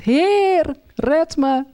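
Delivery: slow mock wail for help; retold desperate cry. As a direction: act out crying out to be saved, without full volume. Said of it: "Schreeuwde het uit".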